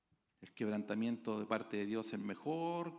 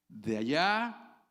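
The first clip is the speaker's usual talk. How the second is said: ordinary delivery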